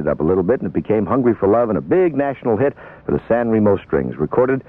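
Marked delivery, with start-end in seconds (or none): none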